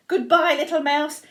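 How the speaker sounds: lightly flustered parting call; hasty farewell. A narrator's reading. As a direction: call it out with mild mock alarm. Fox hurriedly takes leave.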